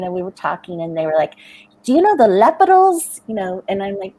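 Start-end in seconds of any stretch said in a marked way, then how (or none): 1.8-3.2 put-on high, louder French-accented question mispronouncing Lepidals; French teenager asking excitedly about the Lepidals, in a French accent. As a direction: ask "Do you know the Lepidals?" as a French teen would. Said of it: "With their accent, it was coming out".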